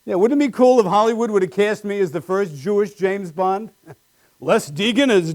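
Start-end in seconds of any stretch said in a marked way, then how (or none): none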